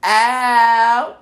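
loud, high, drawn-out whoop; rousing party cry; good-time holler. Shown as a demonstration. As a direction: holler it like the party just started. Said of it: "what you say when you having a good time".

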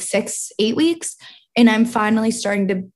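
normal talking voice

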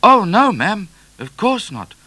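high, loud, hasty-sounding denial; crying out. A narrator's reading. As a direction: protest to the Queen, easing off after the outburst. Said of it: "cried Midnight".

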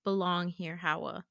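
low, soft, steady comfort; earnest reassurance. Retold or imitated. Hands on her shoulders, he promises she belongs, softly earnest. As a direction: drop the voice and sincerely reassure. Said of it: "looks me dead in the eye".

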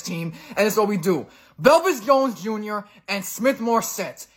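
loud furious rant at the Bears; angry rant. Real, not acted. Fan ranting into his phone about the Bears, furious yelling. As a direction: tear into the team, shouting. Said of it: "I'll throw the clip in here of me ranting about it".

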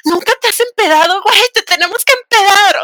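high, loud mocking voice of friends egging her on; mock the pushy friends, pitch up and push; pushy party friends. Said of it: "y el clásico".

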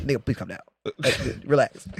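low, soft calming plea; hushed plea. Retold or imitated. Friend calming down heated Rob, low hushed plea. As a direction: drop it low to settle him.

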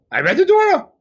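high, loud punchline; triumphant boast. Acted. Rabbi outdoing two colleagues, triumphant boast. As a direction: raise pitch and volume for the winning boast.

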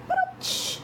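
"Ba-dum, tsh" breathed very high with no voice; a tiny whispered drum hit; "Ba-dum, tsh" as a thin airy cymbal sound; whispered high rimshot. Shown as a demonstration. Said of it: "heard the drums playing".

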